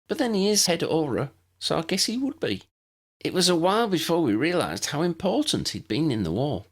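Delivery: a hint of a polite first-year; Lydia the new girl. Lydia voiced in the story.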